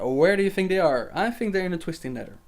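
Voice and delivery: ordinary talk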